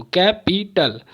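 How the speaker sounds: "Capital" in three beats, कै, पी, टल; syllable by syllable. Shown as a demonstration. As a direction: chop "capital" into three parts. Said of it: "तीन बार मेरे रुकावट आ रही है". ("Capital" को तीन हिस्सों में तोड़कर)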